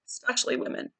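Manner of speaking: softly whispered repeat of "especially women". Shown as a demonstration. Repeats phrase, then whispers it.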